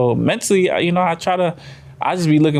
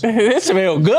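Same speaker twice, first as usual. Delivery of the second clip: laughing; 8 semitones higher, much slower than usual. Acted. Laughing along, mimicking high.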